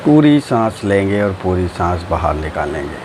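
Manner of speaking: low, slow drawn-out meditation voice; guided meditation. Acted. Teacher guiding friends through breathing and relaxing, guided meditation.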